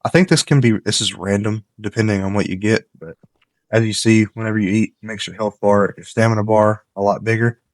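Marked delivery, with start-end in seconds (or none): none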